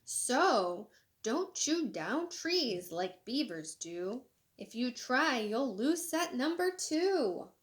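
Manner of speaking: lively, sing-song storybook reading; animated picture-book read-aloud; reading to children. Acted. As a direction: read it bright and bouncy for kids. Reads the moral lines.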